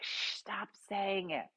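soft, slow shushing; hushing. Retold or imitated. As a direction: hush a friend before she draws attention. Friend hushing a loud friend.

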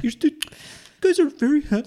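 doting mom voice. Retold or imitated. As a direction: gush like a proud mother. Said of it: "And I was like".

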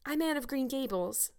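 timid, high-pitched introduction; tremulous. A narrator's reading. Anne faces the stern old lady, tremulous. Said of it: "said the small visitor tremulously".

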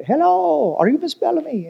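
high-pitched, badly faked Jamaican accent; horrible Jamaican accent. Preacher imitates concierge greeting his wife, bad Jamaican accent. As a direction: swoop up high in a hammed-up attempt at Jamaican. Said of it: "a horrible Jamaican accent".

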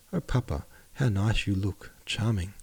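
ordinary delivery, nothing marked

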